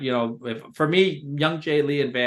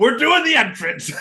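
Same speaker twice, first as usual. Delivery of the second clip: high, loud mock announcement; hammed-up thrilled exclamation; excited imitation; 11 semitones higher, 6 dB louder than usual. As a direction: burst back in with the news, pitched way up. Retells wrestler returning with news.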